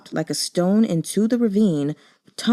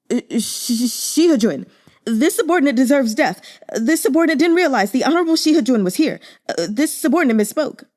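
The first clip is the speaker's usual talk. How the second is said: higher, louder, fast frightened babbling; panicked stammer; 6 semitones higher, 4 dB louder, faster than usual. Acted. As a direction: stammer out apologies in a rush. Guard panics, kneels before superior.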